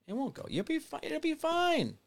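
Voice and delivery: soft, very high falsetto; small high sing-song voice; mock reassurance. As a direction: squeak it out in false comfort.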